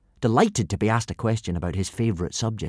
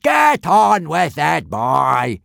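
loud, slow impatient yell; teacher yelling at a rambling pupil; 7 semitones higher, 8 dB louder, much slower than usual. A narrator's reading. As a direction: cut the boy off. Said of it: "yelled Gobber".

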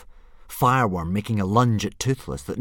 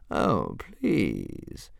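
drawl. A narrator's reading. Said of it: "she drawled".

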